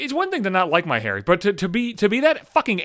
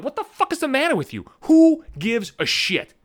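pitched-up exasperation; exasperated outburst; 9 semitones higher than usual. Real, not acted. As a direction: vent in disbelief.

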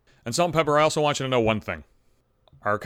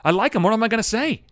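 helpless pleading; 8 semitones higher, 4 dB louder than usual. Acted. Torn about embarrassing a kind coworker.

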